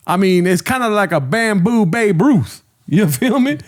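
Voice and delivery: high-pitched parody of slangy hype; mock host catchphrase. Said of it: "That's another one he says".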